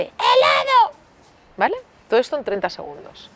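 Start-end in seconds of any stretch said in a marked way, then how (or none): 0.0-1.0 put-on very high, stretched toddler cry; toddler yelling. Daughter yelling at the wall over the ice cream. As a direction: howl it like a little girl in a tantrum. Child writing on wall shouting.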